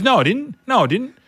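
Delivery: high, whiny denial; mock-protest like a caught kid; indignant denial. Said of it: "He goes".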